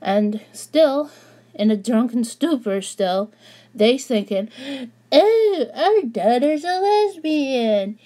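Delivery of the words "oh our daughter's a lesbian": high, slow cheering parents; mocking drunk parents. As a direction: gush it like tipsy parents.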